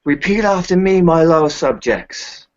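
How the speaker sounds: high, loud, slow royal decree; roaring tyrant king. Acted. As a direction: proclaim it to your subjects. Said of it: "he will say".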